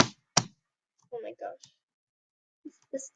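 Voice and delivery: slow whispered oh my gosh; slow despairing whisper; exasperated whisper. Real, not acted. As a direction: sigh the words out unvoiced. Exasperated at failure.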